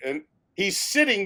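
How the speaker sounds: normal talking voice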